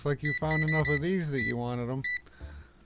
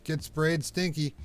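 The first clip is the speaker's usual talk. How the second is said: teasing taunt; 6 semitones higher, 4 dB louder, slower than usual. Pretend. Taunting stinky customer.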